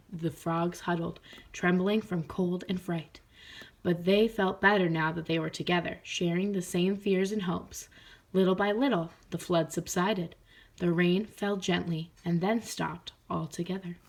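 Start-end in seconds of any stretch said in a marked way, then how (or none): none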